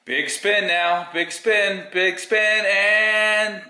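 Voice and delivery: high, drawn-out rising chant; game-show hype. Pretend. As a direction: build suspense like a wheel spinner. Drawing the winner, spinning the comments.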